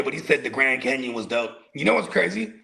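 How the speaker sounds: ordinary talk, nothing marked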